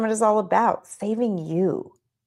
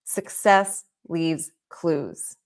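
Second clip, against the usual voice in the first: the motto, slow and lower; slow emphasis; 3 semitones lower, much slower than usual. Shown as a demonstration. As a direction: say it slowly again, letting it land. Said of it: "Hear me on that".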